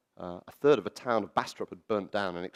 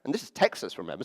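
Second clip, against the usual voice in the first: ordinary talk, nothing marked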